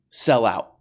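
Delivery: ordinary delivery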